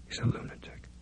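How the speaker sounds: voiceless breathy whisper, barely there; terrified whisper. Acted. Lydia whispering to herself, alone with captor: terrified whisper. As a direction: hush it like a thought you dare not say aloud.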